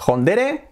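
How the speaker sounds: Hondere said "on-DE-re", high and playful; mock the Spanish Hondere; Spanish mispronunciation. Shown as a demonstration. Teasing the local version, Hondere mockingly. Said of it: "que decimos aquí".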